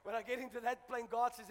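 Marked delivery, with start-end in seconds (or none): none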